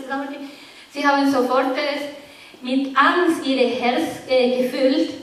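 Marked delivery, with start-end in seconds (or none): none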